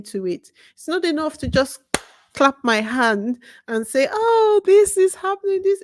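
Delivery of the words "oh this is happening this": high-pitched mimicked gasping reaction; mock dismay. Speaker mimics just reacting to news, mock dismay. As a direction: imitate someone uselessly exclaiming over the news, pitched well up.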